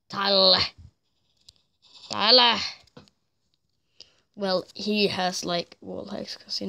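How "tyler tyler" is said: loud call of Tyler's name; loud repeated name call. Real, not acted. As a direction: call Tyler across the room. Calling a teammate.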